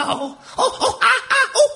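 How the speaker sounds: pained yelps. Acted. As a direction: yelp as if shocked. Luxor straining, shocked.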